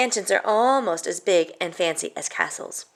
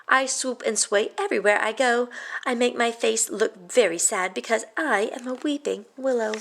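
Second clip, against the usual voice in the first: usual speaking voice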